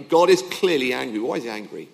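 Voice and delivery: normal talking voice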